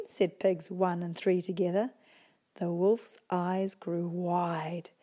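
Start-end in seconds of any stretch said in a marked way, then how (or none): none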